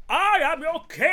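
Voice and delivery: roaring tyrant king; slow, high, screamed royal proclamation; Paul Giamatti. Retold or imitated. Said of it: "That was my Paul Giamatti".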